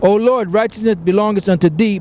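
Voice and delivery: ordinary talk, nothing marked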